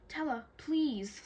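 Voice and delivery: begging. A narrator's reading. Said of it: "Scarlet begged".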